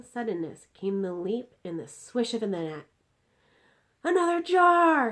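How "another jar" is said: high, loud shout of delight; excited cry. Acted. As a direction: call out for another jar. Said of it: "he cried".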